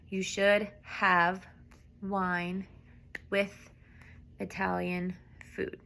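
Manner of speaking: "You should have wine with Italian food." slowly, word by word. Shown as a demonstration. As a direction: spell it out slowly with pauses. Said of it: "You should, need to, or supposed to".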